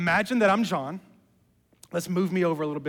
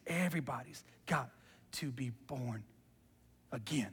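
much softer and lower, sinking into a whisper; hushed; 6 semitones lower, 13 dB quieter than usual. Acted. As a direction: let it fade to a breath. Jesus' words recalled, hushed.

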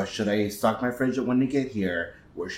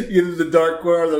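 laughing; 5 semitones higher, 3 dB louder, faster than usual. Real, not acted. Laughs while explaining the image.

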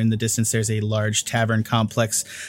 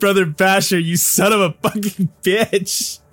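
laughing curse; 8 semitones higher than usual. Real, not acted. GM breaks up at the accent.

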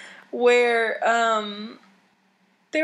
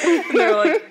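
ordinary talk, nothing marked

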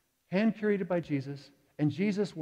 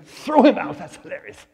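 loud pitched-up British impression, fading at the end; loud English impression; 3 semitones higher, 12 dB louder than usual. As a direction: boom it out like a jolly English host. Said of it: "he'll say".

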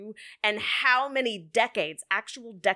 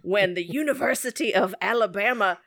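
fairy-tale storytelling; 3 dB louder than usual. Pretend. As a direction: tell it like a bedtime story. Tells the football result as a fairy tale.

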